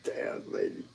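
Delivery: a breathy whisper to herself; whispered aside. Real, not acted. Whispering to the card lady, amused disbelief. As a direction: whisper it like a private reaction.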